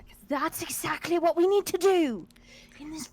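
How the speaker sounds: loud, high, fired-up shout; rally the sorority at the top of your voice; rowdy rallying cry. Acted. Character rallies herself to drink.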